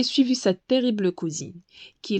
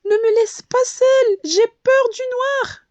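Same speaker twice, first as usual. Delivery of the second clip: high, loud frightened plea; tearful plea; 13 semitones higher, 5 dB louder than usual. A narrator's reading. The lost bee alone at nightfall, a high tearful plea. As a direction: beg not to be left alone. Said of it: "avant de fondre en larmes".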